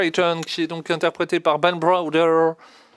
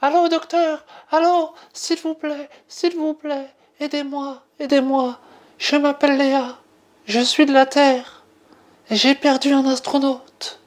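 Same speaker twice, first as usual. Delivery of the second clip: jeune fille paniquée, voix bien plus aiguë; voix de Léa; 8 semitones higher than usual. Acted. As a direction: supplier le docteur d'une petite voix aiguë. Appel de détresse joué en rôle. (Léa appelle le docteur à l'aide, voix aiguë suppliante)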